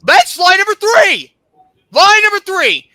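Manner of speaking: high, loud, drawn-out call-out; incensed shout of accusation; angry accusation. Real, not acted. Angrily tallies the admin's lies.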